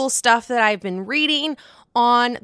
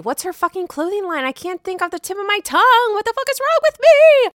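rising, louder self-annoyed outburst; frustrated outburst; 10 semitones higher, 6 dB louder, faster than usual. Real, not acted. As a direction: get mad at your own memory. Frustrated she can't recall name.

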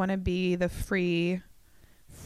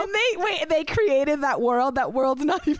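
excited; 6 semitones higher, 4 dB louder than usual. Real, not acted. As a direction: build the idea up excitedly. Excitedly building sitcom idea.

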